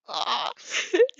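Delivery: high giggle breaking out; real laugh. Real, not acted. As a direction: laugh at the text. Reader laughs at own fanfic.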